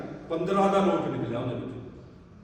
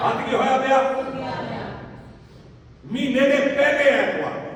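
louder, higher pulpit preaching at full force; fervent preaching; 8 semitones higher, 6 dB louder, slower than usual. Acted. Preacher telling the congregation how to give, fervent loud preaching. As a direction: drive it home to the congregation.

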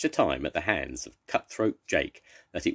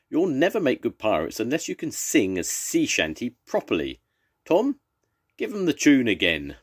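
loud, higher fussy captain scolding his crew; scolding pirate captain; 6 semitones higher, 5 dB louder than usual. Acted. Captain telling Tom to give the tune again, loud and high. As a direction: lecture the crew, then call up to Tom.

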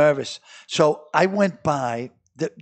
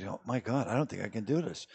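softer, hushed retelling of his own panic; panicked muttering to himself; 5 semitones lower, 13 dB quieter than usual. Retold or imitated. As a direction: mutter it to yourself as dread sets in. Panicking alone in the car.

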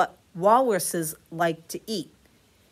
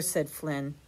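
plain reading voice

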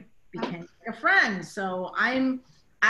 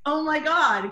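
embarrassed shock; 7 semitones higher, 6 dB louder than usual. Retold or imitated. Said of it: "'cause I was embarrassed".

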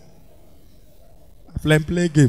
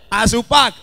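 ordinary delivery

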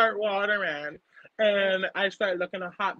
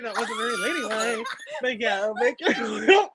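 laughing; 3 semitones higher than usual. Real, not acted. Laughing after burping on camera.

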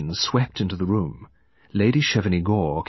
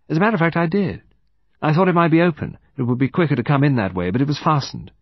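brisk, higher-pitched, louder account; young woman explaining; 6 semitones higher, 3 dB louder, faster than usual. Acted. Woman accounting for her movements to detective, readily.

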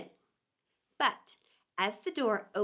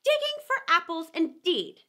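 high, louder scornful repeat; angry rabbit; 5 semitones higher, 5 dB louder than usual. A narrator's reading. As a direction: throw his words back at him, annoyed. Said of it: "said the rabbit angrily".

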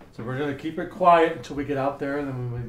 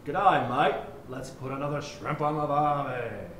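doctor models a stock Australian line; teaching the patient an Australian greeting; Australian accent demo; slower than usual. Shown as a demonstration. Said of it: "Repeat after me".

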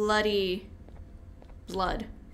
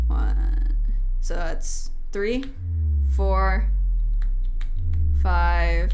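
loud, deliberate egg count; slow, loud counting; 3 semitones lower, 7 dB louder, much slower than usual. Real, not acted. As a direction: call out each number. Counting eggs aloud, emphatic.

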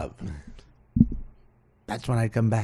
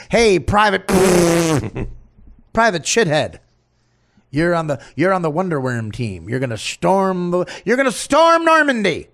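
mock military shout, louder and higher; drill officer; 4 semitones higher, 5 dB louder than usual. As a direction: order the troops into battle, hammed-up. Acting a military officer barking orders.

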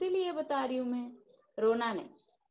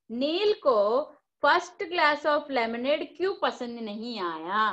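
normal talking voice

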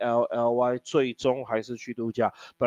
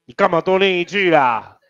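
raised-voice exasperated telling-off; loud scolding; 3 semitones higher, 7 dB louder than usual. Real, not acted. Teacher scolding a student who read an extra line, loud reproach.

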